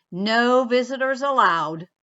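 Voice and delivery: loud, firm NO on the sign's words; bear's loud refusal. Acted. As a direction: bark it at the mouse. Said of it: "Bear said".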